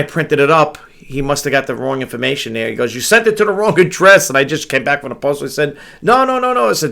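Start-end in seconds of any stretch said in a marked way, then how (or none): 2.9-4.3 higher, louder mock alarm; alarmed quote, pitched up; retold concern. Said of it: "he was concerned". 6.0-6.7 high-pitched string of protests; imitated urgent soothing, pitched way up; retold hasty reassurance. Hurrying to reassure the worried buyer.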